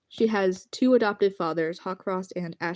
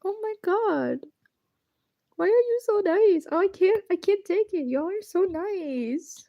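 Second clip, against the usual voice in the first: squealing overwhelmed; 9 semitones higher than usual. Real, not acted. Overwhelmed by kind chat.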